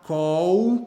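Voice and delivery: "call" ending in a Brazilian U, "cow"; slow Brazilian learner's "call"; Brazilian-accented English. Shown as a demonstration. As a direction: stretch "call" with a Brazilian W-like final L. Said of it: "como muito brasileiro fala um U".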